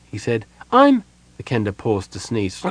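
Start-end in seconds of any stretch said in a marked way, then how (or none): 0.7-1.0 high chirpy start; kender voice. A narrator's reading. Kender about to introduce himself, high and chirpy. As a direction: begin brightly then break off.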